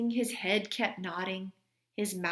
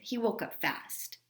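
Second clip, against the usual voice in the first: ordinary delivery, nothing marked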